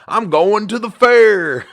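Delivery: high, loud, slow Southern drawl imitation; thick Southern accent. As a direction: ham up a Kentucky Southern accent. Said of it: "thick Southern accent".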